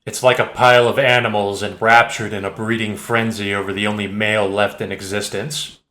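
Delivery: ordinary delivery, nothing marked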